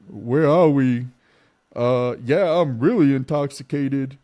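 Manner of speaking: put-on drunk babbling. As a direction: ramble like a wasted passenger. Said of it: "starts doing all this junk like".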